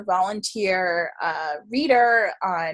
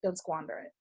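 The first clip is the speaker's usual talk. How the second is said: very soft and lower; gentle retold warning; 5 semitones lower, 14 dB quieter than usual. Older psychic advising a young reader, gentle warning. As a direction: quote a mentor's quiet caution. Retells elder's solemn spiritual advice.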